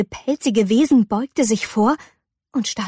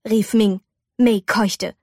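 ordinary delivery, nothing marked

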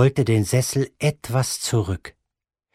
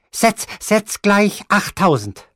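high, slow, pressing command; insistent grandmother; 10 semitones higher, 4 dB louder than usual. Acted. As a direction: drive each word home like a stubborn old gambler. Grandmother voiced in the story.

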